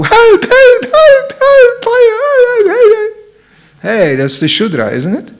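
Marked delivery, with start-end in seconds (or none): 0.0-3.2 very high, frantic squealing cries; mock panic. Most listeners reacting to fire, mock panic. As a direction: squeal for help in hammed-up terror. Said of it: "Most of you will go".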